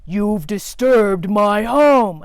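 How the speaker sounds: very slow, ominous spider. Pretend. The spider repeats its accusation, slow and ominous. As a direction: drag it out with slow menace. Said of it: "All I hear is".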